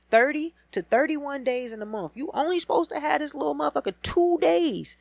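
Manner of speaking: high, loud incredulous repeat; yelling the custody math at Kirk; exasperated repeat. Real, not acted. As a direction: hammer the math home. Repeating the complaint, louder.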